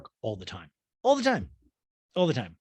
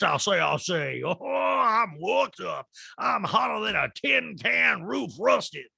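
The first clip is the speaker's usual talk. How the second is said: slow, higher Southern drawl, hammed up; slow Southern drawl mocking Sutton; mock Southern belle; 7 semitones higher, much slower than usual. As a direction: stretch it out like a flustered Southern lady. Sutton's Southern tic mocked.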